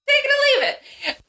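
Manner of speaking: mock proclamation; echoing announcer. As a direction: chime in like a showman. Echoes the mock announcer verdict.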